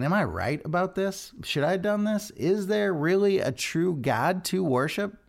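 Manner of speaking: a king second-guessing himself; lightly voiced anxious questioning; doubting king. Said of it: "you get the kind of tone of it".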